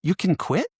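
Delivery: high, rising astonished question; mock disbelief. Soldiers to each other, mock disbelief. Stunned soldiers discover quitting exists.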